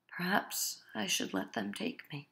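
soft, low, defeated; hopeless surrender. Acted. Husband telling his wife he'll give up, soft and defeated. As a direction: give up quietly, all hope gone.

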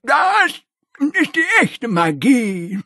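slow high grandpa voice; Opa Jimmy. Acted. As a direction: savor the word like a wise old man.

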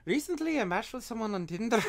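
high-pitched mock Irish; attempted Irish accent. Shown as a demonstration. Taking over the fan email, attempted Irish accent. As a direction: try out a lilting Irish accent. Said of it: "Let me try, let me try".

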